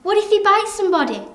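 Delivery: high, loud alarmed mum; scared mum. Acted. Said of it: "Mum said she got really scared".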